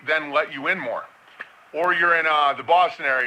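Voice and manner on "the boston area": ordinary delivery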